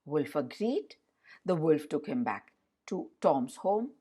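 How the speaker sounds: ordinary delivery, nothing marked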